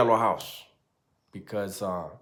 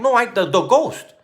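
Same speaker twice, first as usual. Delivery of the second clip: much higher, louder excited friend; friend correcting; 13 semitones higher, 9 dB louder than usual. Retold or imitated. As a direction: blurt it out in a high voice. Friend voiced correcting him.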